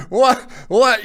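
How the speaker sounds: high, loud, disbelieving "what, what"; stunned what. Retold or imitated. Host imitating John Lynch stunned at his door, high loud disbelief. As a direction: yelp it out like you can't believe the news. Lynch hearing he made the Hall.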